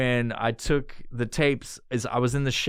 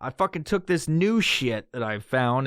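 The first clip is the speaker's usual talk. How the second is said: ordinary talk, nothing marked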